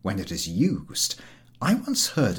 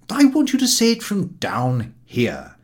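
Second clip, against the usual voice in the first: painstaking German teacher; 10 semitones higher, 5 dB louder than usual. A narrator's reading. German teacher drilling his pupil on Kirche, insistently.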